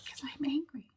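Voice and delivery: breathy whisper, barely voiced; whispered punchline. Telling podcast friends the new song's reason, in a whisper. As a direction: let the last words drop to a whisper.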